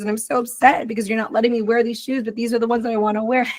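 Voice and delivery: a hint of a child pleading for her favourite shoes; whiny child. Child begging parent to wear the shoes, lightly voiced whiny kid.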